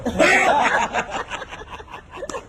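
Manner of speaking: airy high giggle, voiceless; high breathy laugh. Real, not acted. The preacher laughs at his own joke about wives, a high airy giggle.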